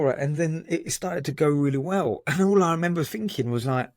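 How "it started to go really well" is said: ordinary delivery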